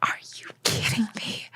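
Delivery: hushed, breathless disbelief; awed whisper. Retold or imitated. Backstage with her mom, an awed whisper. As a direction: whisper it through tears of pride. Tearful mother and daughter backstage.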